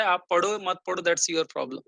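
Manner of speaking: ordinary talk, nothing marked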